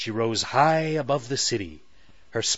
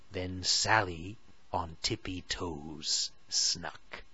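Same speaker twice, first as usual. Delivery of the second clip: hushed sneaky narration; 4 semitones lower, 7 dB quieter, slower than usual. A narrator's reading. Sneaking up on robber.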